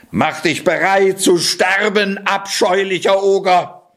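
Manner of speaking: high-pitched challenge, called out; battle cry. A narrator's reading. Cat bursting in on the ogre, calling out. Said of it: "rief der Kater".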